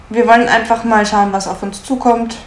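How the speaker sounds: ordinary talk, nothing marked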